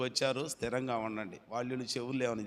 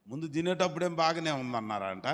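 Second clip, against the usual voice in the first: ordinary talk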